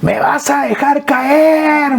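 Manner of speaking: mother crying out as she's lifted; mother's scream. Retold or imitated. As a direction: stretch it out like a scared old mother. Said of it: "me gritaba mi mamá".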